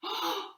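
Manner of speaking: sudden breathless gasp; quick scared gasp; frightened gasp. Shown as a demonstration. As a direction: gasp at a noise. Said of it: "There's something over there".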